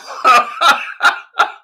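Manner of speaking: a high, unvoiced burst of laughter; high breathy laugh. Real, not acted. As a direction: crack up on air. Laughs at the story.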